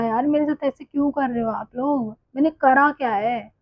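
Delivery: normal talking voice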